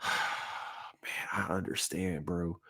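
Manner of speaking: sighed, whispered, touched; emotional whisper. Real, not acted. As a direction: barely breathe it out in sympathy. Moved watching anime alone.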